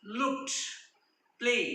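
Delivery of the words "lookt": "looked" ending in T, not D; final T sound. Shown as a demonstration. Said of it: "आपण ट असा उच्चार करतो". ("looked" च्या शेवटी ट असा उच्चार)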